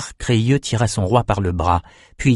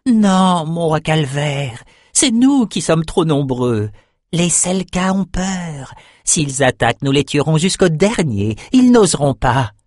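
high, stretched-out shaman voice; excited shaman; 6 semitones higher than usual. A narrator's reading. Shaman tells his king the monsters are afraid, excited. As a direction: insist in a high-pitched drawl.

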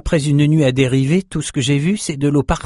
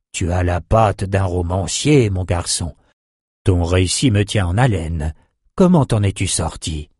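put-on old sorcerer voice; 4 semitones lower than usual. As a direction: speak as a kindly old mage.

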